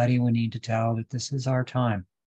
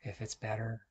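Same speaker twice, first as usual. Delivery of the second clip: very soft, calm meditation voice; guided meditation; 11 dB quieter than usual. Real, not acted. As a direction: guide them into stillness, hushed, with long pauses. Leads a seated meditation.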